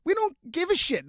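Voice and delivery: very high, mocking dismissal; a shrill hammed-up no. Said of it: "We're like".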